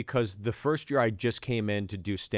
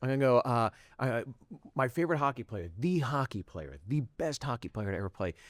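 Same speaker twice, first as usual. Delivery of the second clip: rushed inner plan; faster than usual. Retold or imitated. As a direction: rattle off the plan under your breath. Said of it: "and I'm like".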